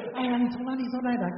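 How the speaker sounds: high, sustained chirpy hello; imitating small talk at a class reunion; gushing reunion greeting. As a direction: greet an old classmate with put-on delight.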